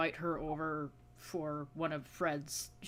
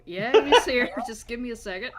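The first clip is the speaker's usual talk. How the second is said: laughing through a phone reply, loud; can barely keep a straight face; cracking up while saying he's here; 5 semitones higher, 13 dB louder than usual. Acted.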